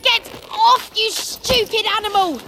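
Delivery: angry shout. A narrator's reading. Ron yelling at Crookshanks to let go. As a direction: bark it at the cat. Ron fighting cat for bag.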